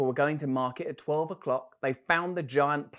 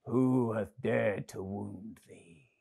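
angry giant; 3 semitones lower, much slower than usual. Acted. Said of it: "his face grew red with anger".

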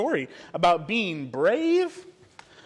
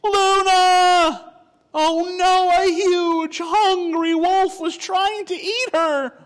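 high, loud cry of alarm; 11 dB louder, much slower than usual. Acted. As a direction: gasp it out, your friend is in danger. Sees wolf attacking Luna.

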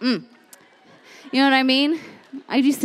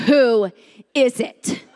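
slow, deliberate challenge, each part set apart; low strong voice; slower than usual. Retold or imitated. Woman rehearsing her challenge at the door, low and strong. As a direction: sound strong and in command. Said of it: "Have a low voice. Sound strong".

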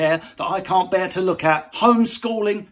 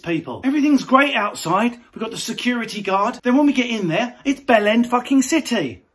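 usual speaking voice

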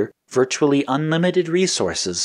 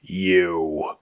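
growled; 2 semitones lower than usual. A narrator's reading. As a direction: growl the accusation. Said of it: "he growled".

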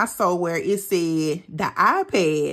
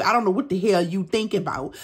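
usual speaking voice

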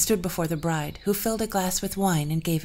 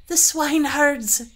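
high, loud, slow cry of pain; the queen confessing in pain, high and slow; agonized queen; 9 semitones higher, 8 dB louder, slower than usual. Acted. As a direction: gasp out the confession.

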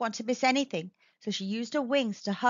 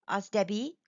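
ordinary delivery

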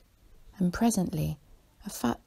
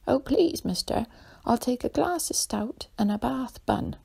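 high-pitched imitation of an old lady; mock old lady, much higher and louder at first; 8 semitones higher, 4 dB louder than usual. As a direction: pipe up with a polite, pleading order. Porter imitates old lady ordering.

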